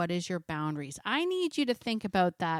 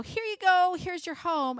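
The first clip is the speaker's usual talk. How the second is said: gushing new owner; 9 semitones higher than usual. Retold or imitated. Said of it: "they open the door and they go".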